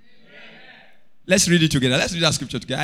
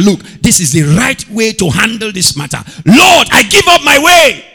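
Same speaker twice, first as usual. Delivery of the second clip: high, loud preaching cry; preaching shout; 7 semitones higher, 10 dB louder than usual. Acted. Preacher crying out surrender to the church, loud.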